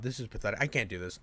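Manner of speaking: defeated mutter. Real, not acted. Reacting to the clip, a defeated low mutter. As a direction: sink into it, barely voiced. This is pathetic.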